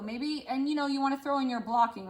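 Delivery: ordinary delivery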